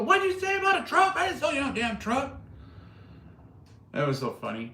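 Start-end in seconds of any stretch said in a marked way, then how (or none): 0.0-2.4 high, loud mock sergeant yell; very high-pitched put-on holler; drill sergeant yelling. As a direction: imitate a sergeant tearing into a private. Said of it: "he would go and yell at them".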